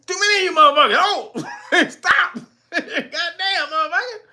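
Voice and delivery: high, laughing panicked shouts; panicked mock mayor. Streamer mocks the mayor panicking at too many arrivals, high and laughing. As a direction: act swamped and backpedaling.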